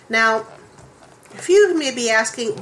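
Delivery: usual speaking voice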